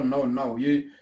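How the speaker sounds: ordinary delivery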